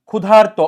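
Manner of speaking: ক্ষুধার্ত said loud with the "ar" sound clear; demonstrated pronunciation. Shown as a demonstration. Teacher has students listen for the r, ক্ষুধার্ত pronounced loudly. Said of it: "আর উচ্চারণ হচ্ছে কিনা দেখেন".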